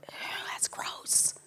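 whispered mock disgust. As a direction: wince it out under your breath. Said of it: "we'd go".

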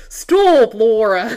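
loud, high mock British shout; super British accent. Co-host echoing the film line to Lara, shouted British accent. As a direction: yell it in an over-the-top English accent. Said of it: "he shouted a word in a super British accent".

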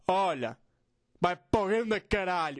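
slow, drawn-out annoyed voice; mock anger, said slowly; friend's angry voice. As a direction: imitate an annoyed friend, stretching the curse. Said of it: "quando está chateado, faz uma voz assim".

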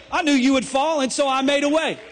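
a hint of Jesus speaking, loud and high; lightly voiced Jesus, firm and sure; voicing Jesus. Jesus telling Peter he made a way, loud.

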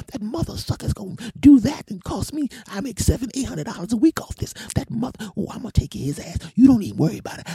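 very high, loud mimicked woman; furious changed woman's voice. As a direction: rant loudly in a put-on high voice. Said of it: "her voice went from this to this".

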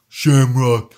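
loud, slow commander on a call; Big Wolf. Acted. Big Wolf calling the pilot back in, commanding voice. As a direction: boom it out as a mission chief. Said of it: "unknown number flashes up on his phone screen".

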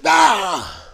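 loud, high, falling wail; mock scream. Reenacting his reaction to the bites, loud high mock scream. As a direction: act out screaming from a dog bite. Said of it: "I was, like, screaming".

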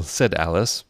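plain reading voice

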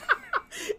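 squealing high laugh. Real, not acted. Laughing at the memory.

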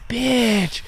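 high, put-on snide voice; a high put-on sneer under the breath; snide insult. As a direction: spit it out in a mocking high voice. Muttered insult in retold scene.

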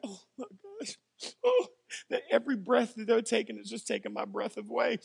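overwhelmed, crying over his daughter; real tears. Real, not acted. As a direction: voice breaking into crying. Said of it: "And then I start crying".

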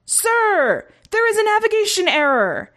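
high, alarmed crew shout. A narrator's reading. Helm blob alerting commander, shouted. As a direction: call out the report high and urgent. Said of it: "the helm's blob shouted".

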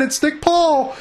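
high, excited name call; commentator's voice leaping with excitement; voice up an octave. Real, not acted. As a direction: jump up in pitch on the player's name. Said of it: "my voice goes up an octave".

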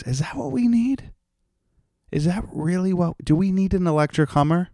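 high-pitched, incredulous questioning; exaggerated squeaky-high disbelief; mock incredulity. Mock outrage at electric luxury cars.

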